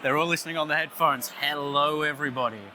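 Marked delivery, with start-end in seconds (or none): none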